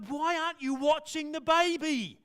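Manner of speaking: mother alarmed at her daughter. Acted. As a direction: demand it like a panicked mum. Mother voiced in retold story.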